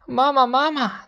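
high, eager calling out. A narrator's reading. Baby geese calling out high to the bear, eager. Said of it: "他们冲着臭美熊喊".

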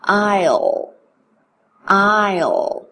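"I'll" said as one syllable like "isle"; contraction drill. Shown as a demonstration. Teacher drilling "I'll" for students to repeat. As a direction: say "I'll", never "I will". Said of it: "大家来跟我练一下".

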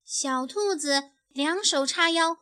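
usual speaking voice

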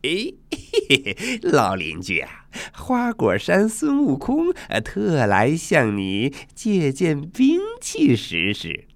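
giggling, high-pitched monkey hero; playful monkey greeting an old neighbour; cheeky Sun Wukong. Acted. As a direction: grin and wheedle with swooping pitch. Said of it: "他笑嘻嘻地说".

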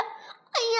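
high, sharp cry of fright; startled yelp. Acted. Startled dinosaur slipping off the mountain, high frightened yelp. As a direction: yelp as you lose your footing.

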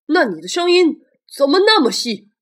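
loud, suspicious dinosaur voice; T-rex voice. Acted. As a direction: rumble it out with suspicion. T-rex questions the stranger.

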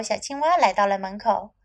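plain reading voice

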